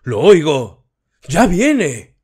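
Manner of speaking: high, loud shout, feigning rapture; a man faking ecstasy to join the frenzy; faked shout. A narrator's reading. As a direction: shout to fit in with the crowd. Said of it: "gritó".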